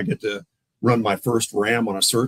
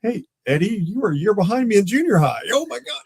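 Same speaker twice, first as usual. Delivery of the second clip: high-pitched retold amazement; astonished recognition; 10 semitones higher, 4 dB louder than usual. Officer recognizes suspect from junior high, astonished. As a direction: act out suddenly placing an old schoolmate.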